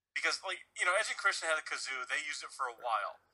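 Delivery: ordinary talk, nothing marked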